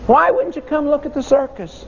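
put-on high, quick children's question; puzzled kids asking. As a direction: ask like baffled schoolkids. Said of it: "and we said to him".

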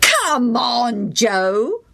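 put-on high boyish taunt; boys yelling. A narrator's reading. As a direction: call him over again. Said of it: "they yelled again".

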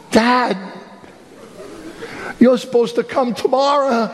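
put-on surprised teenage son woken up; sleepy son's voice. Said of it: "He said".

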